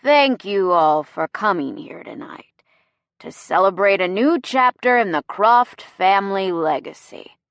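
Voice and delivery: tipsy host making a speech; boozy, slurred toast to a room; drunken slur. A narrator's reading. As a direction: let the word endings run together. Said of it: "His words are running together a bit at the ends".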